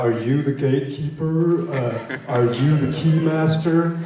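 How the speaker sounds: light movie-character impression, drawn out; Rick Moranis. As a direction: lightly quote a quirky film character, stretching the question. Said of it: "Rick Moranis from Ghostbusters".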